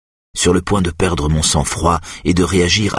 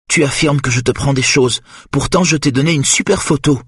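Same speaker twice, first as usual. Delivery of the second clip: unstable woman; 9 semitones higher than usual. Acted. Eva bargaining, unstable.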